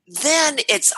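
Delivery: normal talking voice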